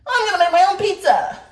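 high, loud cheery vow; hammed-up high bright promise; mock cheerful self. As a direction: chirp it like a happy excuse. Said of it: "I'm like".

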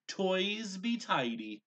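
high, loud, slow magic command; grand spell command. A narrator's reading. Gabriel ordering the kitchen clean, loud slow spell. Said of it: "he commanded".

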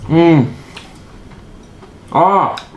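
chewing while talking; words through mouthful of food; mouth full. Real, not acted. Seen 1: Left speaker chews with bulging cheeks while talking, before and after.

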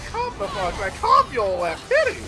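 high-pitched, comic scolding; mock exasperated telling-off. Player venting about promoted coworker, high mock-exasperated scolding. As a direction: rant it with the pitch jumping up.

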